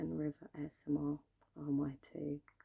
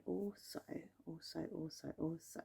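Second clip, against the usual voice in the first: ASMR whisper; 2 semitones higher, 5 dB quieter than usual. Acted. ASMR repetition trigger.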